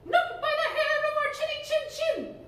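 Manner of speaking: high, loud squeaky pig voice; little pig voice. Acted. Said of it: "playing the role of the pigs".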